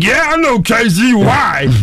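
put-on high, drawn-out drunken bragging; hammed-up drunk claiming a famous friend; drunken boast. Drunk man boasting to a stranger about Casey, drunken boast. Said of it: "'Cause I'm drunk".

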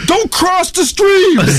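very high, slow shouted line; Ghostbusters shout. Retold or imitated. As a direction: belt it like a movie quote. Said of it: "I'm like".

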